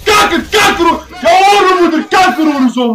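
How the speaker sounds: yelling until the voice cracks, real rage; hoarse raging shout. Real, not acted. Streamer losing his voice screaming at chat, hoarse yell. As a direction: scream it out with a worn-out voice. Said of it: "mijn stem gaat weg".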